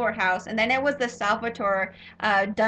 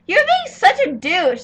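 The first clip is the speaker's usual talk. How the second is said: loud high jab, calling him a douche; scornful retort; 8 semitones higher, 9 dB louder than usual. Retold or imitated. Vlogger calling a character a douche, loud and scornful. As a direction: toss the insult out sharply, loud. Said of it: "And I was just like".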